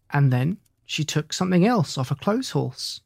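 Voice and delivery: plain reading voice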